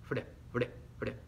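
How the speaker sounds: Fre, fre, fre with a single light tap; soft r drill; soft tapped r. Shown as a demonstration. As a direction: keep the r in Fre gentle. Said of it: "suena suave".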